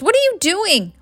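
high, shocked question; aghast. A narrator's reading. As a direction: demand an explanation, appalled.

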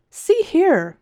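high, loud See here; indignant high cry. A narrator's reading. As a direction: call out to stop her. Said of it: "cried the man".

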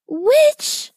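very high, rising startled question; shocked exclamation. A narrator's reading. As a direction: exclaim in alarmed disbelief. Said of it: "Elsie exclaimed".

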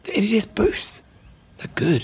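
secretive whispered plug; whispering. Pretend. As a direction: hush it like a secret. Joke sponsor plug.

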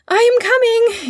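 very high, loud call; excited boy's shout. A narrator's reading. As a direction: call out across a distance. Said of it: "he shouted again".

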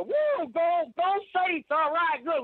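high, excited whoop; retold excited cheer for the Saints; cheering Saints fan. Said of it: "and I go".

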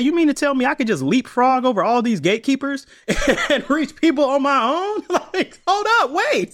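high, laughing incredulous question; laughing disbelief. Retold or imitated. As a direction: laugh through it, can't believe the luck. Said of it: "I said".

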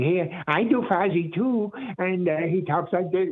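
mock a convention fan's clumsy Fozzie voice; bad Fozzie impression. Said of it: "those who come up to me at the conventions".

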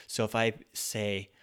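ordinary talk

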